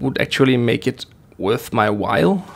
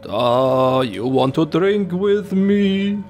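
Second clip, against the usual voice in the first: put-on playful Russian accent, drawn out at the end; mock Russian accent; 3 semitones higher, much slower than usual. Player inviting someone to drink vodka, mock Russian accent. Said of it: "I'm talking in this voice now, in this accent".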